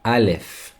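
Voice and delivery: "Aleph" said clearly for repeating; model pronunciation. Shown as a demonstration. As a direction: say "Aleph" as a teacher leading learners.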